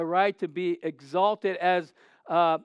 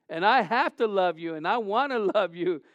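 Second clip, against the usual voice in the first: high, loud preacher's cry; fiery preaching; 5 semitones higher, 6 dB louder than usual. Acted. Pastor preaching love to his church, loud and high. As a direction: preach it out to the crowd.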